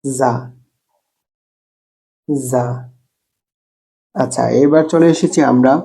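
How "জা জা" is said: জা repeated a bit harder; firm za. Shown as a demonstration. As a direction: buzz the জা firmly each time. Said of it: "আপনিও পড়ুন". (শক্ত করে জা)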